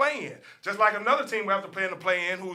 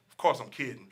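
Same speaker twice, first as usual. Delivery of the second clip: ordinary talk, nothing marked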